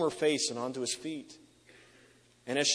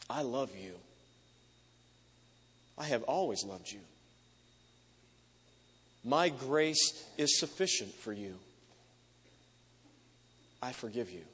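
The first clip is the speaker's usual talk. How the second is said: tender. Acted. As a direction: lay each word down gently. Jesus speaks tenderly to the woman.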